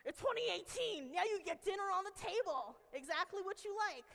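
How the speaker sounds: high, loud, boastful comeback; triumphant retort. Retold or imitated. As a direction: answer the doubters like a proud showman. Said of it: "I'm like".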